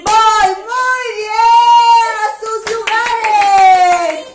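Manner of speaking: loud, high call to places. Real, not acted. Teacher calls kids back. (llamado fuerte y agudo)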